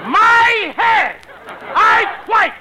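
high, loud panicked yell; panicked shout. Acted. Lou panics and quits.

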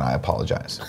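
quiet, grudging mock apology. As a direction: drop the voice to a sheepish murmur. Mock apology after a dispute.

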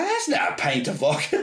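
Scottish clerk's correction, imitated; imitated Scottish accent. Clerk correcting the tourist about the bottle, imitated Scottish accent. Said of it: "I started doing her accent back to her".

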